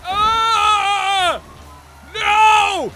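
high, loud drawn-out screaming; frantic high-pitched holler; panicked scream. Real, not acted. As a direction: scream in alarm at a crash. Crash in the game.

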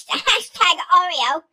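put-on high, loud gushing girl voice with a giggle, slowing at the end; bubbly girl impression. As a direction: ham up the over-excited waitress. Voicing the waitress again.

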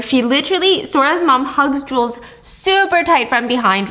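ordinary delivery

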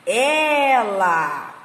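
"Daniela" very slow, in separate long syllables. Shown as a demonstration. Teacher sounding out a name for children, slow syllables. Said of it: "a junção dessas sílabas".

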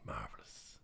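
unvoiced whispered marvellous; whispered aside. Real, not acted. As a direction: breathe the word out as a private aside. Whispered aside after pause.

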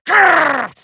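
short villain growl with effort; frustrated growl. Acted. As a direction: growl through the attack. Villain attacking.

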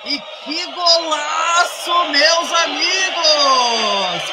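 long drawn-out goal shout, high; explosive goal commentary. Real, not acted. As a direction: scream the goal call, stretching it. Radio commentator calls a goal.

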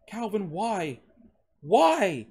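high cry building to a loud wail; distraught, high-pitched questioning; anguished demand. A narrator's reading. Revived man demands an answer.

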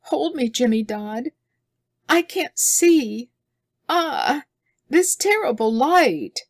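high, slow frightened plea; pleading in pain. A narrator's reading. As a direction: beg to be held, blinded and scared. Girl blinded by dawn light.

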